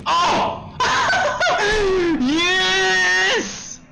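very high, ecstatic shriek of relief; triumphant scream. Real, not acted. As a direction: scream in victory after terror. Escapes at last.

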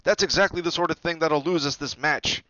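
loud, high scolding shout; exasperated captain's shout; angry yell. A narrator's reading. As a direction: yell it at the whole team. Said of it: "he yelled".

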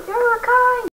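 "Uruk-hai" cried out high and falling; shouted Uruk-hai. Acted. Shouted warning of enemies.

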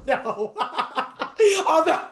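laughing through the words; amused laugh mid-sentence. Real, not acted. Student guesses "robotic" for rubato.

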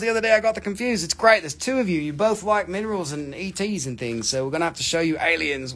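ordinary talk, nothing marked

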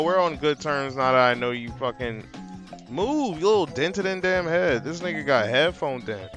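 ordinary talk, nothing marked

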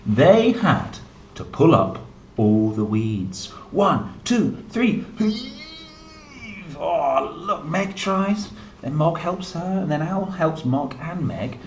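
lively storybook reading; reading to children. A narrator's reading. Reading a picture book aloud to a small child, animated and gentle. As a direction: read it like bedtime storytime for a little one.

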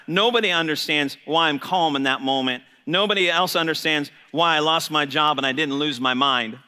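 ordinary talk

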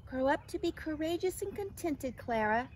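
lifted, storybook farewell call; calling out tenderly. A narrator's reading. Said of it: "she called to the, her egg".

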